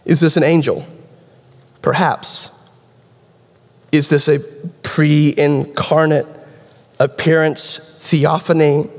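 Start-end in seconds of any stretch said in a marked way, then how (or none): none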